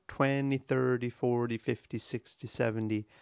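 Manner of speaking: "twenty, thirty, forty" chained long-short, each "-ty" light; sinking sing-song from "twenty" to "seventy"; swinging count rhythm. Shown as a demonstration. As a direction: lilt through "twenty" to "seventy", stressing every first syllable. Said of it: "You hear that rhythm there".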